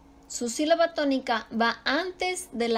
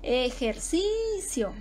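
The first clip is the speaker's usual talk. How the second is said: Ejercicio slowly, e-jer-CIII-cio; drawn-out middle syllable in Ejercicio; stressed CI; much slower than usual. Shown as a demonstration. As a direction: hold the first CI of Ejercicio long. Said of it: "pues, pasa lo mismo".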